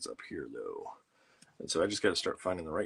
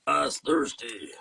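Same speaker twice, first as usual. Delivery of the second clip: slow and louder, higher Sling Blade voice; Billy Bob Thornton impression; 6 semitones higher, 7 dB louder, much slower than usual. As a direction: lean into the hammed-up Sling Blade voice, slowly. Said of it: "my imitation of Billy Bob Thornton".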